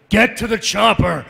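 strained yell in a character voice; Adam Jensen. Shown as a demonstration. Actor showing how hard yelling as Jensen was, strained slow yell. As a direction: yell it slow, trying to hold the character's register. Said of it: "trying to lower my voice to keep it".

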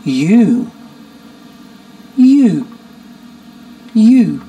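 easy, ringing You; smooth onset you. Shown as a demonstration. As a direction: glide into You with no catch. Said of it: "Say this after me".

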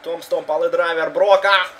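rising excited wrestling call; excited commentary. Real, not acted. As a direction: call the big move with excitement. Excited play-by-play of wrestling game. (возбуждённый комментарий)

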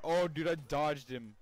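slow, loud laughing relief. Real, not acted. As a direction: laugh it out after escaping. Laughing after dodging.

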